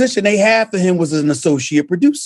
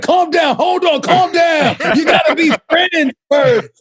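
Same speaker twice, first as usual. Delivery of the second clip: high mock shout at a fighting couple; imitating Martin; 6 semitones higher than usual. Host stops a fighting couple on stage, shouting high. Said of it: "And Martin like".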